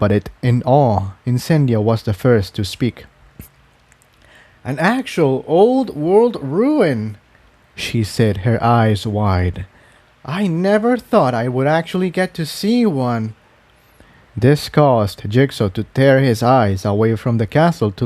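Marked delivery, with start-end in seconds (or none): 4.6-7.2 put-on higher, slow, wide-eyed young mare; higher awed filly voice; awed Incendia. 10.2-13.3 higher awed filly voice; awed Incendia. Acted. Incendia speaks.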